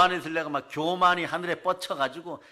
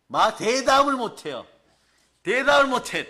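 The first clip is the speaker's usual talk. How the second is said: much higher, louder emphatic cry; preaching climax; 7 semitones higher, 6 dB louder than usual. Real, not acted. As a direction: drive it home at full force.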